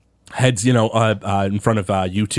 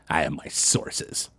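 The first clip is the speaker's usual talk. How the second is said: sly hush; 9 semitones lower, 10 dB quieter, slower than usual. Acted. Sly reply in tavern standoff.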